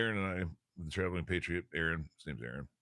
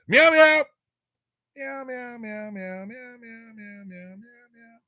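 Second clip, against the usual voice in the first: cat meowing; 13 dB louder, much slower than usual. Pretend. Streamer meowing to chat in a high, fading cat voice. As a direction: meow like a playful kitten, trailing off.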